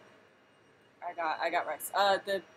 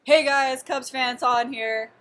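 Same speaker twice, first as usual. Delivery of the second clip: drawn-out, raised video greeting; channel intro; 4 semitones higher, 6 dB louder, slower than usual. Shown as a demonstration. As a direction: open the show with stretched-out words. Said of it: "how do I do my intros".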